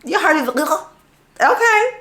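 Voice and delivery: excited. Real, not acted. Reacting to app audio.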